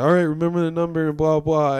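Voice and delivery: imitating a hasty parting instruction; hurried reminder. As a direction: rattle it off as a rushed send-off.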